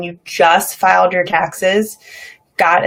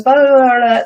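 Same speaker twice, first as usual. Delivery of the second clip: higher, louder run of blahs; racing-mind babble; 6 semitones higher, 5 dB louder than usual. Retold or imitated. Showing listeners what her mind's going, panicked babble. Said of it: "that's what my mind's going".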